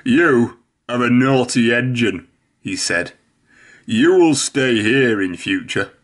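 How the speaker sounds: cross fat controller. A narrator's reading. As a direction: tell off a naughty engine. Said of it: "very cross with her".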